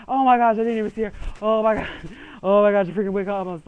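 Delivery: loud, fast frantic gasping; freaking out over a game witch; panicked. Retold or imitated. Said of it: "I'm all like".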